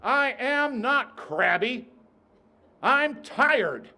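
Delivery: put-on loud, high, slow protest of a toddler; child shouting in church, loud and high; loud child's protest. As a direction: yell it like a cranky toddler in church. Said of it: "the young boy spoke up loudly".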